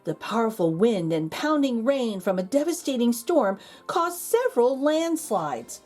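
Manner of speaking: ordinary delivery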